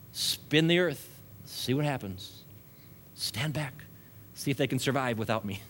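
soft, detached musing to himself; aloof God. Acted. Preacher voicing a hands-off God watching the earth, aloof and quiet. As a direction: toss it off quietly, as if idly watching.

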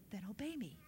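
soft, low instruction; hushed, grave word from God; quiet command. Retold or imitated. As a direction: command gently, without raising the voice. Voices God's quiet command.

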